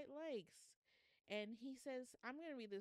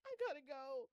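high, loud, tearful exit line; half-laughing, too moved to go on; overwhelmed, gotta go; 7 semitones higher, 8 dB louder than usual. Real, not acted. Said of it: "I feel like Wendy Williams in that one clip".

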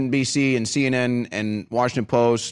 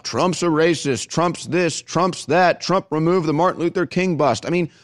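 ordinary talk

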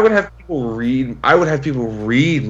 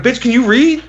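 indignant retort; 12 semitones higher, 4 dB louder than usual. Retold or imitated. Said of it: "I'm like".

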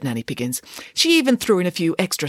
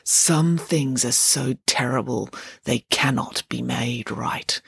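sadly; 3 semitones lower, slower than usual. Acted. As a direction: speak gently with regret. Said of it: "she said sadly".

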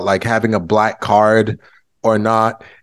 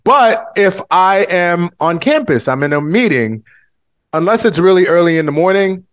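higher campus voice; 6 semitones higher than usual. Shown as a demonstration. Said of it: "my voice goes up an octave".

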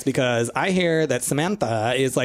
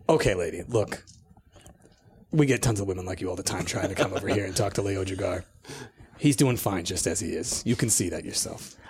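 gruff guard voice; 7 semitones lower, 5 dB quieter than usual. Acted.

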